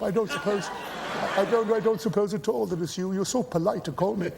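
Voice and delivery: a dithering elderly gentleman, hint of a chuckle; imitating Gielgud. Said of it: "he sort of speaks like that".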